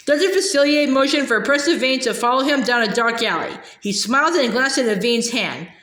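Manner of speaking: usual speaking voice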